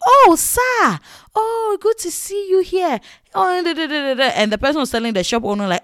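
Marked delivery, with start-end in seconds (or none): 0.1-4.2 fawning greeting. Retold or imitated. Fawning shop staff greeting.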